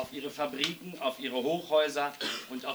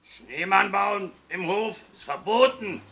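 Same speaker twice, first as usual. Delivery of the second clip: loud, raised, strict caretaker voice; stern; 8 semitones higher, 9 dB louder than usual. A narrator's reading. Caretaker forbids children a snowman, stern and loud. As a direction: lay down the law loudly. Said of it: "sagte dann streng".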